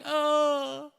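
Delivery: hammed-up wail to Jesus for help; helpless cry. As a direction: play the fallen sheep calling out. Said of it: "We fall and we're like".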